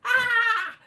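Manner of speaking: loud high laughing cry of despair; half-laugh, half-wail at the bad news; exasperated laughing yell. At Crewe when the train is cancelled, a laughing wail.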